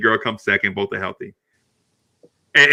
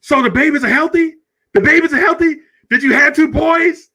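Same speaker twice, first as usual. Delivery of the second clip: other dad; 4 dB louder than usual. Retold or imitated. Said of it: "the guy's like".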